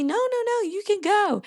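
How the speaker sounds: high-pitched imitation of urgent onlookers; retold urging. Onlookers correcting a confused racer, mock urgent.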